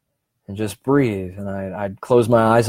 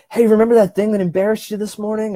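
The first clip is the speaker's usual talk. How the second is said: high, quick, teasing brain voice; nagging inner voice; 11 semitones higher, faster than usual. Retold or imitated. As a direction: needle yourself like a pest. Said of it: "your brain will say".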